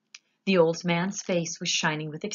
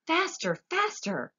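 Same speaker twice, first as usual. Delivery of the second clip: much higher urgent cry; impatient cheer; excited urging; 12 semitones higher than usual. A narrator's reading. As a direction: push it on. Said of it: "cried Mister Wonka".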